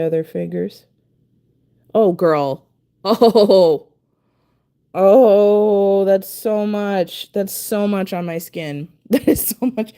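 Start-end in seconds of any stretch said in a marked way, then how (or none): 1.9-9.9 laughing, loud, at her own mess; laughing dismay. Real, not acted. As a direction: laugh it out in disbelief.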